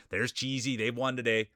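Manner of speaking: ordinary talk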